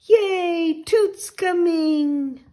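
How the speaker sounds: high, drawn-out squeal of joy; excited cheer. Acted. Puddle hearing Toot is coming home, excited. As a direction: cheer with a little cry of delight.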